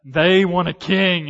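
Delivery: loud, slow, indignant outburst; a retold prophet's loud angry complaint; angry Samuel. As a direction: spit out the words in loud disgust. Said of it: "and he's angry".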